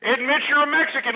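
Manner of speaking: sing-song mocking goad, pitched high; taunting. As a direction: needle the caller to confess. Host taunting caller.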